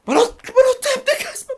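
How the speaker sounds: high, loud furious yell at the game; full-throated raging shout; angry shout. Real, not acted. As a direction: scream it at the screen in frustration. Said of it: "مش هينفع أزعق".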